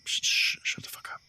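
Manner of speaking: urgent whispered hush. Acted. Host whispering at Baxter to shut up, hushed. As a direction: hiss it so the caller can't hear. Hushing cohost while caller listens.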